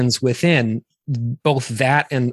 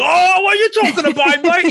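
exaggerated English accent, much higher and louder; overdone English accent; 4 dB louder than usual. Dad mocking his own bedtime-story voices, overdone English accent. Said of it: "every English person is like".

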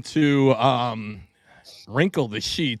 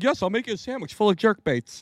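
higher-pitched mock agreeable voice; imitated meek reply; 9 semitones higher than usual. Joking about a boring husband making sandwiches, mock agreeable voice. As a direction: playfully voice an obliging partner. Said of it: "He'd be like".

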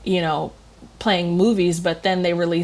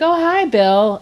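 higher, louder mock greeting; retold greeting; 6 semitones higher, 6 dB louder, slower than usual. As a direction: call out a surprised hello, as retold. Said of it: "and I was like".

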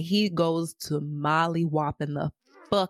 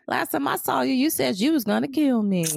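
voicing Nate taunting; 6 semitones higher than usual. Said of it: "He like".

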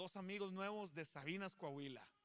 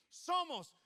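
higher, louder call of the word Somos; 8 semitones higher, 7 dB louder than usual. Shown as a demonstration. Pastor leads the congregation's call-and-response, calling out. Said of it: "yo voy a decir".

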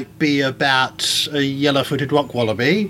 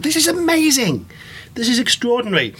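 much higher, thrilled exclamation; amazed; 9 semitones higher than usual. Retold or imitated. Reading about megafauna for the first time, amazed and thrilled. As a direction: gush with wonder at the discovery. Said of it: "I'm like".

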